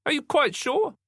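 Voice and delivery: high-pitched detective interrogating; questioning detective. A narrator's reading. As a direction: press the witness in a high voice.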